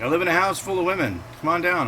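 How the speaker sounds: coaxing friend. Retold or imitated. Friend's invitation voiced in story.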